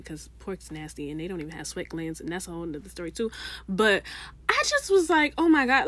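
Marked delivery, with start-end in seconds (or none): 4.5-5.9 high retold shock; aghast. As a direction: replay the dismay.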